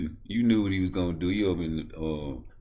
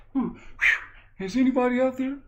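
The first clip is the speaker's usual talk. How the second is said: very high put-on voice; high-pitched son character; squeaky son. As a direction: squeak it out to dad. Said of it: "you can talk normal now".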